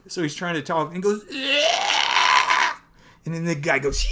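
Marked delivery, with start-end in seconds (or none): 1.3-2.7 high, crying shout breaking through; strained shout. Acted. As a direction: burst out with the first word. Man finally forces out speech.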